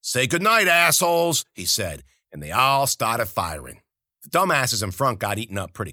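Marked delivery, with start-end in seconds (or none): 0.0-1.5 high, loud sneering taunt; mocking shout. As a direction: bark it out as a gleeful threat. Villain taunt before firing.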